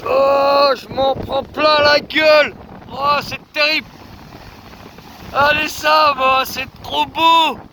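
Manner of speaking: thrilled cries into the wind; excited shouting. Real, not acted. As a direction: yell with delight.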